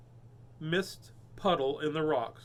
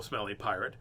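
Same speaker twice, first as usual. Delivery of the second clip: ordinary delivery, nothing marked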